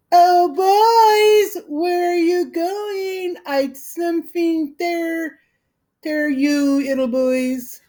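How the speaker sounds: mock a little girl teasing the boys; shrill girlish voice. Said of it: "called in a shrill, girlish voice".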